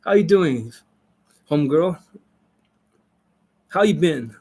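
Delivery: casual street greeting, lightly put on. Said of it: "or you're more, like, American kind of".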